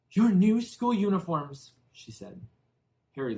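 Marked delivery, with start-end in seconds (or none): 0.1-1.7 high, loud and slow, stiff; high loud slow curt reply; sharp aunt. A narrator's reading. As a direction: spit out each word tightly. Aunt Petunia curt answer.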